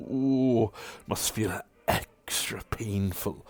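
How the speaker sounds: hissed mock sympathy; the villain whispering close to Stark's ear; gloating whisper. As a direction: whisper the cruelty with pleasure. Sirik gloats.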